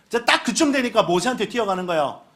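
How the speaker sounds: ordinary talk, nothing marked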